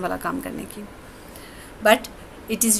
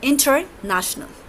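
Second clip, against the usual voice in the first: slow, split word; 4 semitones higher, much slower than usual. Shown as a demonstration. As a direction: say international very slowly, inter then national. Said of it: "inter is its prefix". (international को बहुत धीरे, inter और national अलग करके)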